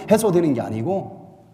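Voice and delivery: usual speaking voice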